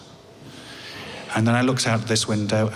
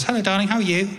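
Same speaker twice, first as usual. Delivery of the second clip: higher-pitched phone greeting; imitated wife; 9 semitones higher, faster than usual. Wife phoning her husband on tour, lightly imitated higher voice. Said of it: "my wife says".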